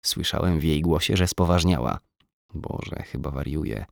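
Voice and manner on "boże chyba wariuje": whispered inner panic; whispered thought. A narrator's reading. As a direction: breathe it as a private thought.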